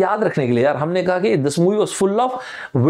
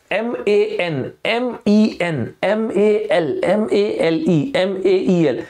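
slow spelling; 3 semitones higher, much slower than usual. Shown as a demonstration. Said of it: "इस तरह से जितने words".

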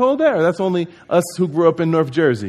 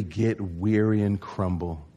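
hushed warning; 7 semitones lower, 7 dB quieter, slower than usual. Real, not acted. Sermon drops to a hush.

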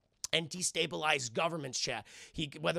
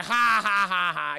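mocking fake laugh; 2 semitones higher, 9 dB louder, slower than usual. Council laughing at Killmonger.

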